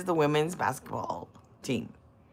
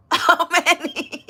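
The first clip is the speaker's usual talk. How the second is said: high, slow, words breaking into laughter; cracking up on the question; laughing; 8 semitones higher, 5 dB louder, slower than usual. Real, not acted. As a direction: squeeze it out through a laugh. Laughing at the light bulb joke.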